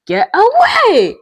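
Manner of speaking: loud high drawn shout. Real, not acted. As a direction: yell it slowly. Shouting at opponent.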